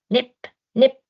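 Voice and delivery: high sharp nip nip; nipping sound. A narrator's reading. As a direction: bite out each nip. Biting sound effect.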